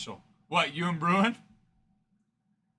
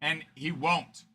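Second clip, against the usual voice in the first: ordinary talk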